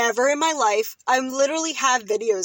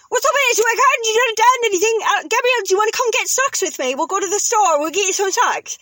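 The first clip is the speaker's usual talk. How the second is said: high, loud London chatter; British family voice; 9 semitones higher, 5 dB louder than usual. Retold or imitated. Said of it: "your whole family talks like".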